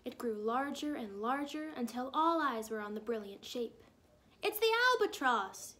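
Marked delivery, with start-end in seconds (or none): 4.4-5.8 high, loud excited yell; snowman's yell. A narrator's reading. A snowman announcing the albatross to the town, yelling. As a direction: call it out to the whole town. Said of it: "yelled a snowman".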